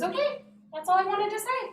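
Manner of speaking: high, upbeat wrap-up; cheerful principal dismissal. Retold or imitated. Principal finishes apologizing and dismisses child, cheerfully high. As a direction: send the child off brightly.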